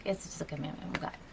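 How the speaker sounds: quiet, fast, dismissive murmur of "It's just a commandment from God"; bland and empty. Retold or imitated. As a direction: toss it off like a rote answer with no feeling. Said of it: "so bland, and I had no connection".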